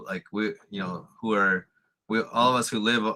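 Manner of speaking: ordinary talk, nothing marked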